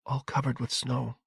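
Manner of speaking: put-on hushed little boy's reply, barely let out; whisper. A narrator's reading. Boy answering his father about the hedges, whispering. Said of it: "he whispered".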